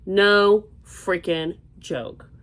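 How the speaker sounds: "No freaking joke" spelled out slowly, each word hit; slow emphatic. Real, not acted. Insisting to viewers how hot Florida is, slowly and emphatically. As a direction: drag out every word for emphasis.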